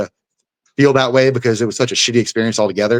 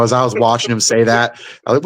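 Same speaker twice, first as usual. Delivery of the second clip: usual speaking voice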